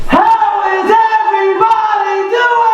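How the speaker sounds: high, slow, stretched shout to the crowd; slow rallying shout. Real, not acted. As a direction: pull a bigger answer out of the room. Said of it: "Let's try that one more time".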